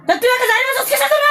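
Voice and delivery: high angry retort; defiant shout. Acted. Possessed patient argues back. (উঁচু রাগী চিৎকার)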